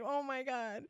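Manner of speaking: high, teary mock cry. Retelling her meditation, mock teary cry. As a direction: replay the tears half-laughing. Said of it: "I start crying".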